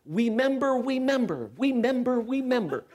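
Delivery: put-on high child voice, we member for remember; bouncy kid saying we member; says w for r. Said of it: "he's bouncing around the room going".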